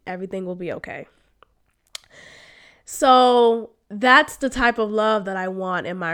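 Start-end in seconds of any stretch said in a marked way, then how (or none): 0.1-1.1 quiet, gentle replay of a comforting phrase; soft reassurance. Retold or imitated. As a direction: murmur it softly to yourself like a memory. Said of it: "I replay that memory of Joanna saying".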